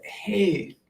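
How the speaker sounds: put-on high, soft and tender, like soothing a child. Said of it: "I came in as my higher self and I said".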